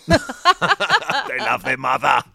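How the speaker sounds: loud, drawn-out hammed-up Cockney; slow, over-the-top Cockney; Cockney gangster. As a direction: bellow it like a sentimental Cockney gangster. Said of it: "I don't think the Krays are dragons".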